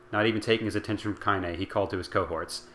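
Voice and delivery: ordinary delivery, nothing marked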